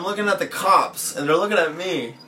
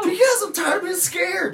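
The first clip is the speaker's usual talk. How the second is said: high-pitched exasperated cry; exasperated outburst. Real, not acted. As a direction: blurt it out, pitch shooting up. Exasperated answer about changing tattoos.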